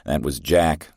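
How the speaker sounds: ordinary delivery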